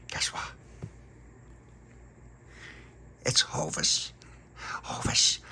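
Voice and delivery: secretive whisper. Acted. Tony shares a secret.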